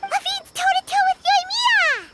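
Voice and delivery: high-pitched excited call; excited cheer. Acted. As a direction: cheer on a close race. Companion cheers on the race.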